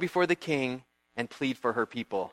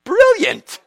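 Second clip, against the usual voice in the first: high, loud scheming delight; hammed-up wicked glee; delighted villain; 8 semitones higher, 7 dB louder than usual. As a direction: Haman thrilled by his wife's idea. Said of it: "So Haman's like".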